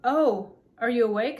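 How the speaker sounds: a hint of a tiny character, emphatic. A narrator's reading. Tiny king noticing Ellie is awake, mild surprise. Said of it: "said the tiny voice".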